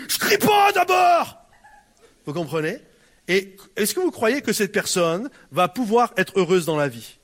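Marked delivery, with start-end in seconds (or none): 0.1-1.4 high, slow yelled protest; imitating a furious woman insisting she isn't shouting; angry denial. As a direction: bark it back, offended. Angry person denies shouting.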